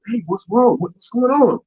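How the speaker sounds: high, anxious questioning; alarmed boyfriend. Acted. Boyfriend asking girlfriend what is wrong, high and alarmed.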